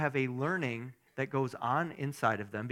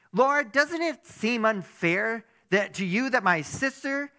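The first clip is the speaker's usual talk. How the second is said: higher, louder upset Martha voice, slowed at the start; 8 semitones higher, 10 dB louder than usual. Acted. Preacher voicing Martha complaining to Jesus, higher and louder. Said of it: "I don't know why her voice sounds like that in my head".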